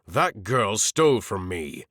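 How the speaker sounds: ordinary delivery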